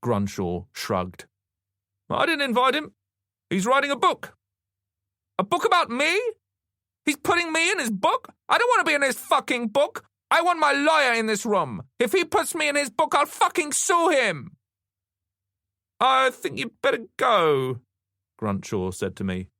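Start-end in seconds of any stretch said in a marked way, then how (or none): 2.1-4.4 high-pitched, loud detective's retort; defensive detective. A narrator's reading. Detective answers the furious woman. 5.4-14.6 high-pitched, loud furious tirade; screaming outrage. A narrator's reading. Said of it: "She was screaming". 16.0-17.8 high, slow, loud order to leave; detective's dismissal. A narrator's reading. Detective orders him out amid tirade.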